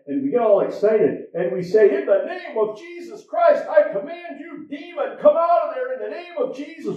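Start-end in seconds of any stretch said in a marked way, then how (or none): 1.9-7.0 high, fervent commanding shout; shouting at a demon. Retold or imitated. Preacher shouting a demon out during prayer, fervent yell. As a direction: cast the demon out at full force. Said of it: "We're almost screaming and hollering".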